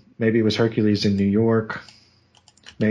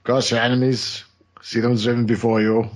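Arnold impression. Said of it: "I can't do his voice".

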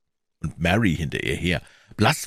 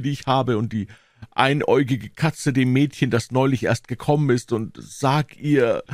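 high, feeble deathbed pleading; hammed-up dying boy; groaning; 7 semitones higher than usual.

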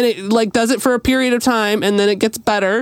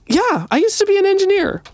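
much higher, breezy quote of the fireman; casual fireman; 12 semitones higher than usual. Retold or imitated. Fireman explaining he can fix the car, high and breezy. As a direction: toss it off like it's nothing. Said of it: "He's like".

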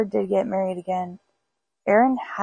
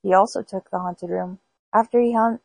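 usual speaking voice

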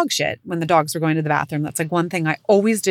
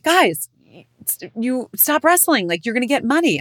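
a raised, higher mock scold at the boys; sharp mom warning, higher pitched; scolding the kids; 6 semitones higher than usual. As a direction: call the boys off, half-yelling.

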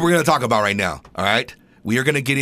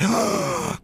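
mock gasp; 14 semitones higher, 5 dB quieter than usual. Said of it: "you're gonna be like".

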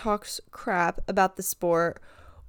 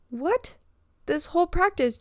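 incredulous; 8 semitones higher than usual. Retold or imitated. Her own disbelieving reply.

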